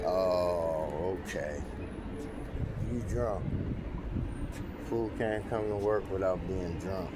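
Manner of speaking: ordinary delivery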